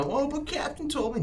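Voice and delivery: high whiny mimic of a crew excuse; high-pitched mock whine; mock whiny crew member. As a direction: mimic them whining about the captain. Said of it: "No, ... nothing like that".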